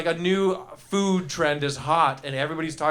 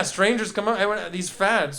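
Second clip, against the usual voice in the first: usual speaking voice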